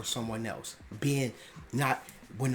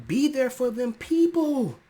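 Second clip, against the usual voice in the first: passionate plea; 13 semitones higher, 7 dB louder than usual. Real, not acted. Passionate plea on mental health.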